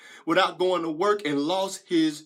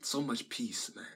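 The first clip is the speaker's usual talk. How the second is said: low, very soft reminiscence; hushed bliss; 8 semitones lower, 15 dB quieter than usual. Acted. Recalling how his first reefer felt, hushed bliss. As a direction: sink into the memory of peace, barely voiced.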